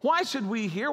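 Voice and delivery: ordinary delivery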